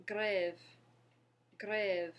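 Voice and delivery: "grève" loud, with French R and open eh; careful French pronunciation. Shown as a demonstration. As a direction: model "grève" firmly for learners. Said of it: "the next word we have is".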